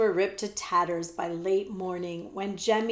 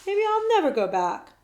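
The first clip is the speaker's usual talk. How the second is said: defiant; 10 semitones higher, 5 dB louder than usual. Acted. Defiant prince.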